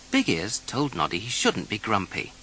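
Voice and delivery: plain reading voice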